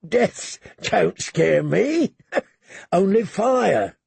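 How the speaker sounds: slow, laughing through words. A narrator's reading. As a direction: laugh it out slowly. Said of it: "Now Clegane did laugh".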